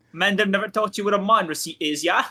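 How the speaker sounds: cheeky cockney jab; mock British accent, said fast; put-on British accent. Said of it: "Why did you say man like that?".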